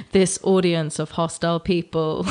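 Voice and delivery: ordinary delivery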